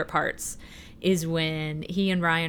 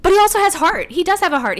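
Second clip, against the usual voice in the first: emphatic; 13 semitones higher, 9 dB louder, faster than usual. Real, not acted. Emphatic praise.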